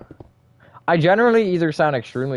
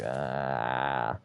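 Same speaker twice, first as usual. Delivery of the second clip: long, low, quiet groan; mock waking groan; 8 semitones lower, 10 dB quieter than usual. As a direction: imitate a drained groan on waking too early. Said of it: "and you're like".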